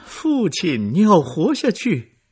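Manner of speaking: high loud desperate plea; anguished cry. A narrator's reading. Daughter crying to her dying father, anguished and loud. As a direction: cry out to keep him alive. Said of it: "科赛特凄惨地叫着".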